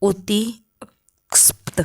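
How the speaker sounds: उत्तिक्स्प्त said slowly, syllable by syllable; उत्तिक्स्प्त drawn out carefully for the class; careful spelling-out. Shown as a demonstration. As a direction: sound out उत्तिक्स्प्त slowly like a teacher. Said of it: "इसको बोलते हैं".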